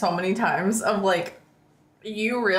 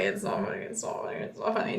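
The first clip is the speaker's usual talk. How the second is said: much softer, ending unvoiced; fading to a whisper; 14 semitones lower, 9 dB quieter, much faster than usual. Real, not acted. Apologising to the friend, repeating it softer and softer into a whisper. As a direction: say it smaller each time till only breath is left. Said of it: "I'm sorry, it's not funny".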